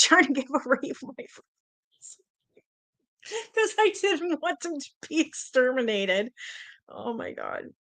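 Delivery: high-pitched, talking through laughter; laughing. Real, not acted. Streamer reminding Teresa of the rat giveaway, laughing. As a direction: struggle to get it out while giggling. Laughing while retelling giving away rats.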